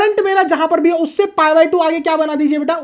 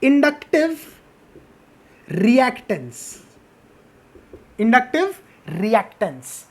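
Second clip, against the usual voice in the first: slow dictation; 3 semitones lower, slower than usual. Shown as a demonstration. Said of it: "इसको हम प्यार से क्या बोलते हैं".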